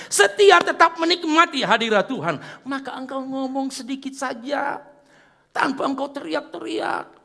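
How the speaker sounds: high, ringing sermon voice; fervent preaching. Acted. Pastor urging congregation to stay faithful, fervent high preaching. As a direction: drive the message home.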